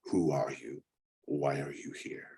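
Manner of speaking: a hint of stone grinding on stone, restrained. Stone mannequin challenges travellers at the guardhouse, understated stone-on-stone voice. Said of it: "voice that sounds like stone grinding on stone".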